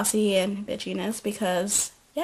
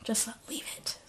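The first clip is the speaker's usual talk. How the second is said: whispered warning, no voice; 15 dB quieter than usual. Retold or imitated. Pin quietly telling Zoe to drop it, whispered. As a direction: hush it like a secret. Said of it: "But Pin's like".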